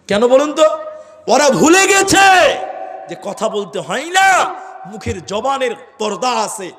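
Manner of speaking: high, loud preaching burst; full-force sermon shout; fiery preaching. Real, not acted. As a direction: hammer the point home to the crowd.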